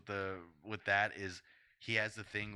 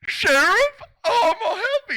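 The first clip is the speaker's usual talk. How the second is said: very high, loud goofy shout; hammed-up excited squeal; eager movie character; 22 dB louder than usual. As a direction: squawk it in an over-the-top character voice. Acting the movie character calling out.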